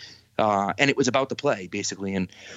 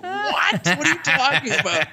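high, laughing squeak of disbelief; can't believe what he's hearing, voice jumps up with a laugh; shocked disbelief. Retold or imitated. Hearing from his wife the game was lost, stunned laughing disbelief. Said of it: "And I'm like".

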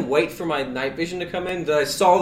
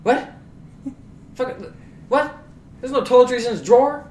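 confused disbelief; 7 semitones higher than usual. Retold or imitated. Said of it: "And I'm like".

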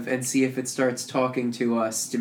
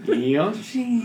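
Yoshi voice; 7 semitones higher than usual. Retold or imitated. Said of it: "the Yoshi voice clips".

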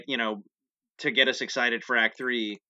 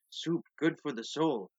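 softer, understated words of wisdom; lightly voiced sage; 7 dB quieter than usual. Soup cook answering his accuser, lightly played wise. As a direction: offer it like a mild wise cook. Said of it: "something wise".

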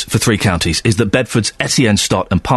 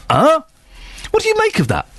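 a baffled, high huh; can you believe this; incredulous huh. Real, not acted. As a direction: pitch leaps up in disbelief. Incredulous at the admission.

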